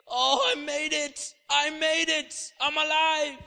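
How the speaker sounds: high, loud cry of relief; jubilant survivor's shout. Acted. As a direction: shout it like someone who escaped death.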